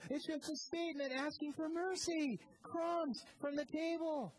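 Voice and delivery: rising sermon fervour, driving each phrase home; preaching at full force. Acted. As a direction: build it into a fervent pulpit climax. Preaching climax, raised voice.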